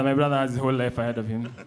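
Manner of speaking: ordinary talk, nothing marked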